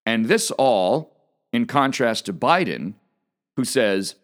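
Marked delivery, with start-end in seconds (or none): none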